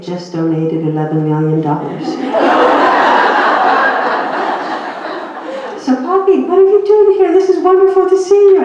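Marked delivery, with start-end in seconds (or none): 2.1-5.8 a long high laugh that falls away; big laugh. Real, not acted. Laughing at the $11 million donation. 5.8-8.6 much higher, louder, gushing hello; delighted greeting. Retold or imitated. The Blocks' delighted greeting retold.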